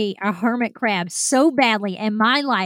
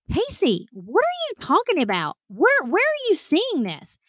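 acted-out puzzled colleagues, pitched up; baffled questioning; 7 semitones higher than usual. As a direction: question it in mock bewilderment. Sergeants baffled by her freak-out.

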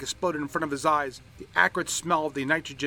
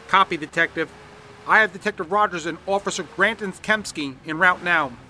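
radio dispatcher; 6 semitones higher, 8 dB louder than usual. A narrator's reading. Dispatcher answers over radio.

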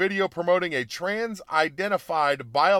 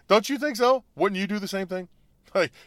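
ordinary talk

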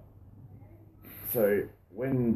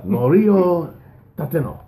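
put-on dramatic Japanese accent, higher and louder; Kill Bill voice; 8 semitones higher, 8 dB louder, slower than usual. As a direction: announce the name like a film villain. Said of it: "so you just do the Kill Bill voice".

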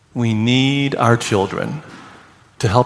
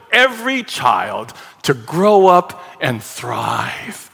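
building from loud and higher to peak, then slowing; rising preaching climax; 5 semitones higher, 3 dB louder than usual. Acted. Pastor preaching to congregation about every child, rising to a loud peak. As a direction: swell the list into a preached crescendo.

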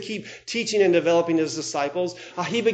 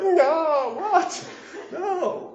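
shocked disbelief; 9 semitones higher, much slower than usual. Retold or imitated. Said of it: "And Peter's like".

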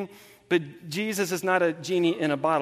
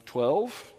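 small, lower, hesitant reply; sheepish answer; 4 semitones lower, 4 dB quieter than usual. Retold or imitated. Disciples answering exasperated Jesus, acted sheepishness. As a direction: answer like a pupil being told off.

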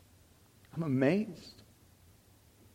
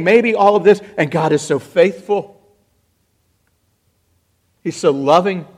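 loud, driving sermon voice, rising; fervent preaching; 4 semitones higher, 13 dB louder than usual. Acted. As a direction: cry it out to the congregation. Preaches at full force.

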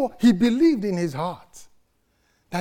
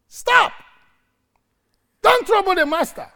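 much higher, sharp scolding shout; crowd scolding; 4 dB louder than usual. Retold or imitated. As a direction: shut the beggar up. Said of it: "shouted him down".

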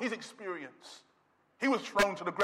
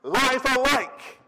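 louder, driving pulpit delivery; full-force preaching; 4 semitones higher, 5 dB louder than usual. Real, not acted. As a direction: preach it out hard to the congregation.

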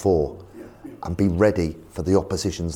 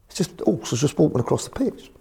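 normal talking voice

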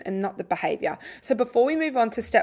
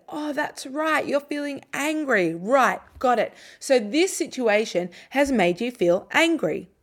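higher, slow reassuring voice; calm, gentle affirming; 5 semitones higher, slower than usual. Shown as a demonstration. Parent confirming the child's pointed-at feeling, higher and slow. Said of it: "use a calm, slow, quiet tone of voice".

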